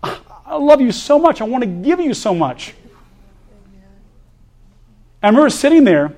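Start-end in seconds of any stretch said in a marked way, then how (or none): 0.0-2.8 loving Father. Retold or imitated. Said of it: "look at you and go".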